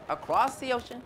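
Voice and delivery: plain reading voice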